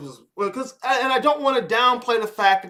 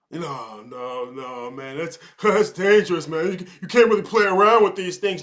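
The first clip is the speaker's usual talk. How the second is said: mock scared refusal; 2 semitones lower than usual. Acted scared refusal quote.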